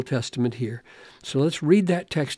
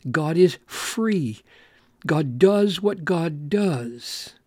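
slow emphatic; slower than usual. Real, not acted. Preacher stressing God's freedom.

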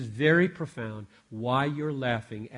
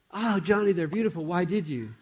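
higher-pitched replay of his wife's pleased surprise; delighted wife; 6 semitones higher, 4 dB louder than usual. Acted. Wife thanking husband for flowers in the replay, delighted. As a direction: act the wife, lifted and gushing. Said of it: "I'm gonna replay the video".

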